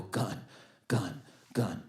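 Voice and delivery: low, soft, spaced-out murmur; said inwardly. Retold or imitated. Man watching a robber approach, murmured inwardly. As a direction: voice a private thought under your breath. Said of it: "what I was saying in my head".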